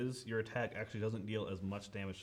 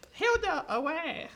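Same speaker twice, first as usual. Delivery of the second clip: high, loud squealing order; Rat King command; 8 dB louder, slower than usual. Acted. Rat King sending Hilda up his arm, high loud command. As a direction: call it out shrilly as a villain to a minion.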